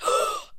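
sharp high gasp of alarm; mock shocked gasp. Friend imitating Paige checking her phone, sharp high gasp. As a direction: gasp as if reading bad news. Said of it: "she goes".

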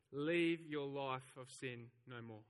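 slowly, every word weighed; deliberate and slow, like a verdict; slow solemn words. Acted. Jesus telling the woman, slow and measured. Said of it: "he says".

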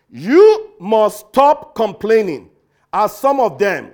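slow, loud, stern command; authoritative command. Retold or imitated. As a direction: lay down the law like an apostle. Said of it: "in the most authoritative tone".

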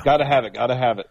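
fast, eager repeated chant; rushed mantra. Retold or imitated. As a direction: rattle it off greedily. Mimics collector mentality.

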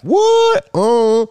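very high shocked squeak; squealed what. Acted. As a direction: shriek it in disbelief.

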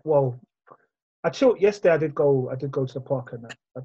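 normal talking voice

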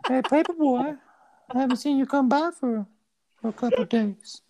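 put-on high, creepy old man on the phone; Herbert voice. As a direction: coax him like a creepy old neighbor. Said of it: "that's a really good impression".

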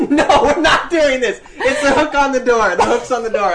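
high, loud exasperation through laughter; laughing protest. Real, not acted. Stopping co-host's legend, high laughing protest. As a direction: shout the spoiler while laughing.

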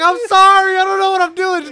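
high, loud, helpless plea; frantic shouted apology. Retold or imitated. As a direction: yell back sorry over the chaos. Acted apology to yelling squad.

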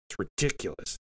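usual speaking voice

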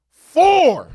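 high, loud shout of "Four."; outraged shout. Real, not acted. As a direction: bark "Four." in disbelief. Fan's outraged punchline.

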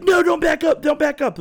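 high, loud alarmed cry of don't back up; imitating panic. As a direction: mimic her frantic warning to stop the wheelchair. She panics about the cat.

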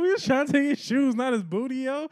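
loud, high objection breaking into laughter; indignant protest. Acted. Gang member objects to the one who went too far, laughing indignantly. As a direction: protest over your own laughing.

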